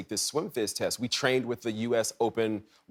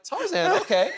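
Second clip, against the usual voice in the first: mock bemusement. Royals react to odd introduction.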